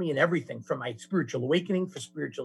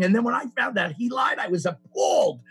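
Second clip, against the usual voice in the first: higher, louder, hammed-up appalled rant; mock outrage; 8 semitones higher, 5 dB louder than usual. Mocking his own hypocrisy, hammed-up appalled rant. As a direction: play up the self-righteous indignation.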